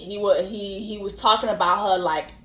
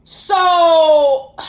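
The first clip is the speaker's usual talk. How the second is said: exasperated; 4 semitones higher, 9 dB louder than usual. Real, not acted.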